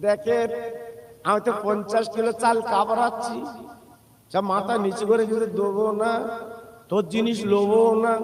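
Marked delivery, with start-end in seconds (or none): none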